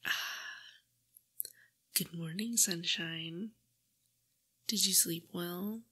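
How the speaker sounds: gentle close-mic whisper, coaxing. Acted.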